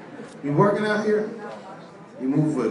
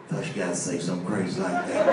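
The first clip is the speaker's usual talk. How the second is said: low calm mock threat; 10 semitones lower, 4 dB quieter than usual. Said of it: "He said".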